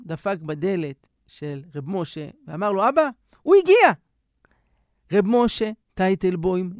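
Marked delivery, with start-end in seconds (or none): 2.9-3.9 high, loud cry of joy; excited announcement. Acted. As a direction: burst in with the big news. Son announces the arrival to father.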